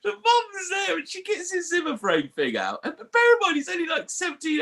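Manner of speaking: squeaky high, laughing through the telling; real breathless hysterics; helpless laughter. Real, not acted. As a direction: struggle to narrate while cracking up. Cracking up while telling story.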